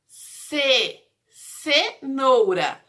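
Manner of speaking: "cenoura" slowly, with "ce" set apart; syllable by syllable. Shown as a demonstration. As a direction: sound out "cenoura", stressing the first "ce". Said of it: "Lembra da sílaba C?".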